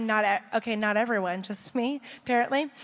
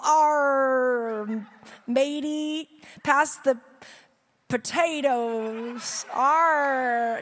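pirate voice; much slower than usual. Pretend. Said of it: "National Talk Like a Pirate Day".

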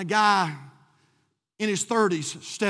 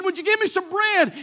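raised-pitch, loud request for bread; pleading friend; 10 semitones higher, 5 dB louder than usual. Retold or imitated. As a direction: call up to a friend's window. Acting out friend begging bread.

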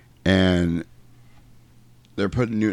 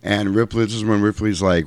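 normal talking voice

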